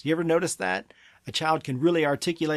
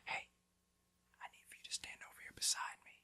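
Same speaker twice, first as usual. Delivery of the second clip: put-on hushed instruction in a child's ear; whisper; 19 dB quieter, faster than usual. Shown as a demonstration. Parent bending over to a child in a restaurant, whispering. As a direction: whisper it very softly, no voice. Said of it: "bend over and whisper in their ear".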